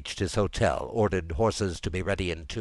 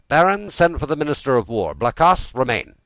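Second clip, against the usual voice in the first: higher, louder commanding king; royal command; 8 semitones higher, 8 dB louder than usual. A narrator's reading. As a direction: issue orders briskly as a king. King gives orders to his ministers.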